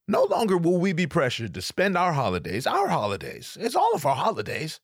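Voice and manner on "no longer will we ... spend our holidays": ordinary delivery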